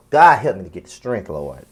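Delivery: weary appeal to God; exasperated plea. Real, not acted. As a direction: pray for patience, dropping low.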